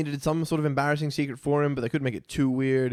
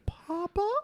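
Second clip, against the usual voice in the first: very high, soft questioning squeak; little cartoon mouse; 5 dB quieter than usual. Podcaster quoting An American Tail, tiny high call. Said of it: "the other one, I'm pretty sure his name is Papa".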